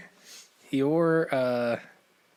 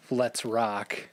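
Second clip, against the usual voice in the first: drawn-out title. Acted. Quoting the song title slowly.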